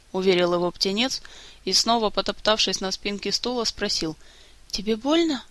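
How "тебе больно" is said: put-on high, gentle boy voice; Efey's voice. A narrator's reading. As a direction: ask with worried care. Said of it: "голосом Эфея".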